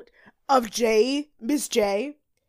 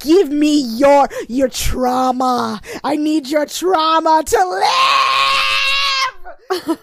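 slow, higher, louder shouted demand; hammed-up greedy Tyra; 6 semitones higher, 7 dB louder, slower than usual. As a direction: bellow it slowly like a hungry monster. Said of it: "She's like".

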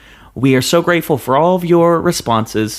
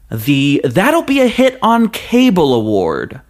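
award announcer; 3 semitones higher, slower than usual. Acted.

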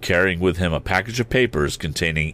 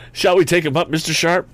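high-pitched youthful question; eager lad; 10 semitones higher, 4 dB louder than usual. A narrator's reading. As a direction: ask it high, as a boy consulting his partner. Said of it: "asked Tom".

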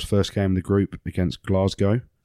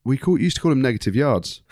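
usual speaking voice

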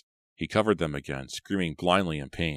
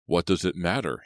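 usual speaking voice

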